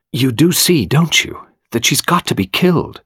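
intense, anxious questioning; nervous. A narrator's reading. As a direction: nervously press for agreement.

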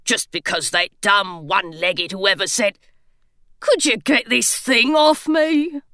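a trapped gargoyle complaining; grumbling gargoyle. A narrator's reading.

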